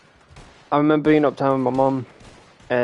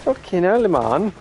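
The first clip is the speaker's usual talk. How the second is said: higher, exasperated clarification; exasperated correction; 5 semitones higher, slower than usual. Real, not acted. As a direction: spell it out to a twit, drawing out the key words. Exasperated at being misunderstood.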